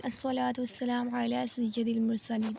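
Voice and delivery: measured, chanted opening invocation; Arabic recitation. Acted. Opening recitation before the lesson. (अरबी अंदाज़ में धीमी तिलावत)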